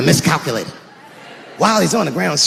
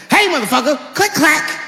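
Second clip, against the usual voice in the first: tough guy threat; 6 semitones higher than usual. Acted. Acted threat racking a shotgun.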